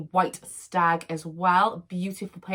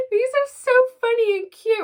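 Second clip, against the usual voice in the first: high, loud squeal of delight; delighted high squeal over cute little things; 8 dB louder than usual. Real, not acted. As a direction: squeal it like you can't contain yourself.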